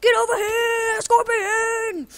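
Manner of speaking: mock battle cry as Scorpion. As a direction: scream it like a fighter landing the move. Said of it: "'cause I'm Scorpion".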